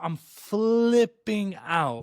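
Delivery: high, hugely drawn-out mock panic; freaking out. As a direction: stretch it out in retold hysteria. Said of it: "And I'm, like, freaking out".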